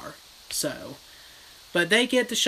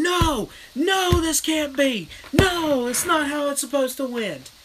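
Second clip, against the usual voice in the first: high, loud anguished cries of no; a brother's frantic wail of denial; desperate screaming; 10 semitones higher, 4 dB louder than usual. Retold or imitated. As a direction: scream it like the ending is being stolen from you. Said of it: "screaming and hollering".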